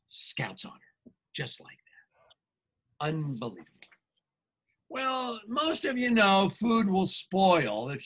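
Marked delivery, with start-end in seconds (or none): 0.1-3.6 hushed aside. Acted. Wolf swearing innocence.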